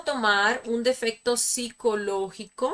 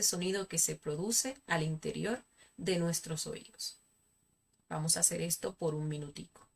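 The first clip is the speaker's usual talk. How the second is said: calm, soft meditation guide; guided meditation; 4 dB quieter than usual. Acted. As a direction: lead them gently into relaxation.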